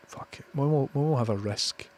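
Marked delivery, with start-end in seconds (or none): 0.1-0.4 even softer whispered fuck it; barely voiced, committing to it; whispered again. Real, not acted. Muttering to himself before the risk, whispered.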